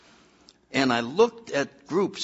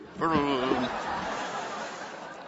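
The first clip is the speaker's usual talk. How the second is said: soft laugh; 5 dB quieter than usual. Real, not acted. Laugh after the punchline.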